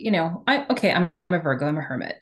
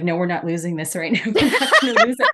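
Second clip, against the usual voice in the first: laughing self-talk; 7 dB louder than usual. Retold or imitated. Telling herself at the event, laughing. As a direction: laugh off the tears. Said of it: "I'm just like".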